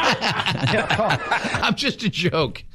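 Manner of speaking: drawn out through laughter; laughing. Real, not acted. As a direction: laugh the words out slowly. Laughs at own joke.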